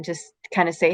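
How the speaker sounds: ordinary talk, nothing marked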